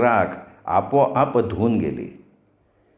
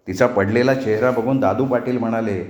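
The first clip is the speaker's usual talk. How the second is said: plain reading voice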